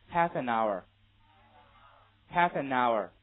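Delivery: "Half an hour" run together, slow and loud; linked reading. Shown as a demonstration. Teacher modelling linking for students to repeat, slow linked reading. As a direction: model "Half an hour" with each final consonant joined to the next vowel. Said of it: "大家可以试着跟我一起读一下".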